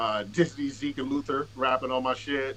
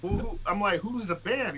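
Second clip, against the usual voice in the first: high surprised question; 8 semitones higher than usual. Retold or imitated. Acting out his own confused question.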